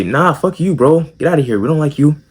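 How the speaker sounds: lightly voiced teasing crowd; the other guys mocking and dismissing the kid; mocking jocks. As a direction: brush him off with a sneer.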